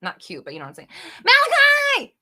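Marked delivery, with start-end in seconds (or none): 1.2-2.2 excited scream of a name; thrilled shriek greeting a friend. Real, not acted. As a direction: yell the name out in delight. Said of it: "Sorry, I didn't mean to scream".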